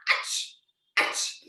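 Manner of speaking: two short mock sneezes; imitated sneeze. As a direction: pretend cat sneezes. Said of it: "Again, catty said".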